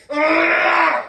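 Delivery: loud, high frustrated roar; angry shout. Real, not acted. Ranting vlogger breaks off into an angry roar. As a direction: let out a furious ugh. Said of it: "I swear, I'm angry".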